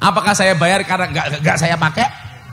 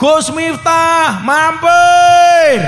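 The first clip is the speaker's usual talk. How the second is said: drawn-out, louder call from the roadside; woman shouting from her doorway to the passing preacher; 7 semitones higher, 5 dB louder, much slower than usual. Retold or imitated. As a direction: call slowly across the street to a passing car. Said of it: "Dia teriak".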